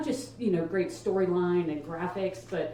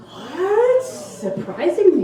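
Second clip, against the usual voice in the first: high, loud "What?"; mock surprise; 10 semitones higher, 6 dB louder, much slower than usual. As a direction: feign shock.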